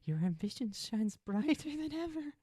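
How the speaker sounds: cackling villain. Acted. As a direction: praise Gold Cocaine with a cackle. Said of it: "the cackling hands is like".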